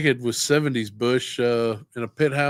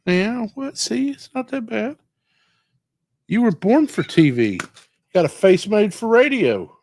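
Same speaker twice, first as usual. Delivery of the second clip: teasing pet talk; 10 semitones higher, 5 dB louder than usual. Real, not acted. Teasing the cat on camera.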